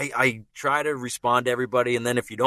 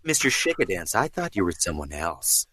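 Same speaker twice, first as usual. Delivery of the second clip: Ryan Reynolds. Acted. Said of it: "it's like a roller coaster".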